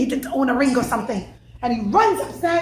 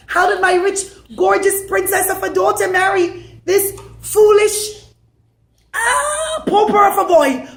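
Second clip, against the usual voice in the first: loud, higher outraged complaint; indignant lament; 7 semitones higher, 6 dB louder than usual. Acted. Father ranting about the poor groom, loud outrage. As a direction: rant in outrage.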